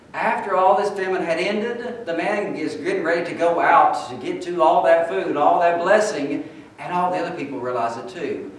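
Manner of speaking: loud, high-pitched preaching, driving the story hard; preaching at full force. Acted. Preacher telling his congregation about the famine, full-force preaching.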